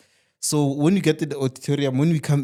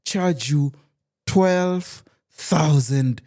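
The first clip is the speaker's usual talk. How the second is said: hyped salesman pitch; 3 semitones higher, slower than usual. Retold or imitated. Salesman slashes price dramatically.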